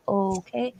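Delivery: soft, uncertain, rising okay; hesitant reply. Retold or imitated. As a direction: retell a wary acceptance under your breath. Wary reply to stranger's introduction.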